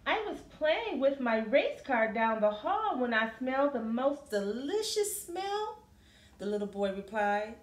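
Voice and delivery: lively, sing-song picture book reading; reading to children. Acted. Teacher reading a picture book to a class, lively.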